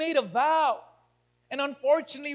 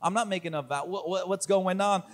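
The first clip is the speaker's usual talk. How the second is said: low, quick, puzzled self-defence; wondering objection; 6 semitones lower, 4 dB quieter than usual. Acted. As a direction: voice the doubter in a fast undertone. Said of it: "You might be wondering".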